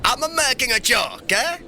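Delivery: high, gleeful teasing; tickled by your own prank; amused reveal. Acted. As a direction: let the joke out in a high-pitched burst. Emperor reveals he was teasing.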